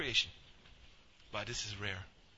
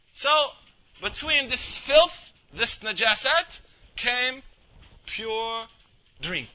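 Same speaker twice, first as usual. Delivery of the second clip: loud, higher and slow, stressing each word; rising emphasis; 6 semitones higher, 7 dB louder, much slower than usual. Real, not acted. Preacher building to the point about pure milk, loud and slow.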